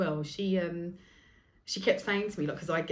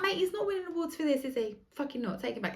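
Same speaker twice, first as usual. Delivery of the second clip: sassy comeback; 9 semitones higher than usual. Retold or imitated. Retort to waiter.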